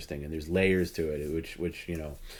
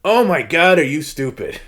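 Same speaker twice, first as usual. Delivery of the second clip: loud, high exasperated outburst; mock exasperation; 7 semitones higher, 9 dB louder than usual. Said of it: "He's like".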